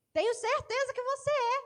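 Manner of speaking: high, loud insistence; insist, high and sure; insistent woman. Acted. Preacher acting the girl accusing Peter, high and loud.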